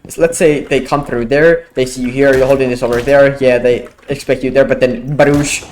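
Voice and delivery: ordinary talk, nothing marked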